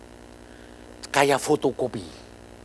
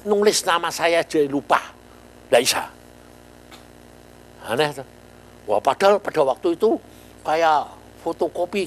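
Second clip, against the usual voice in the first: normal talking voice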